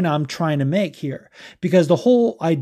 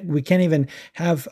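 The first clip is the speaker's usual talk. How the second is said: ordinary talk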